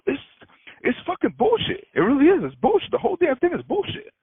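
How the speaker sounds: normal talking voice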